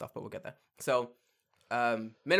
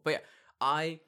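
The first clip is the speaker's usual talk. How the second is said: usual speaking voice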